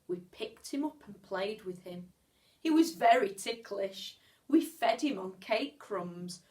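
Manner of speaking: plain reading voice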